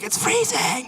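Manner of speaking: high-pitched exaggerated whine; mock whining complaint. Locals never happy with the weather, high mock complaint. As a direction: mimic someone griping about the cold.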